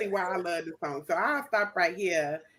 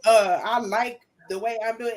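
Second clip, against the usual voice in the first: put-on higher, louder voice of his old stubborn self; 4 semitones higher, 4 dB louder than usual. His old way refusing change, mock stubborn and louder. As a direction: push back like the comfortable old habit. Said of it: "And his old way is like".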